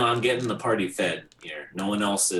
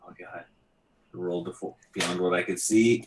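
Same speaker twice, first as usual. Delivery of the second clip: ordinary delivery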